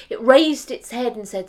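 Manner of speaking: usual speaking voice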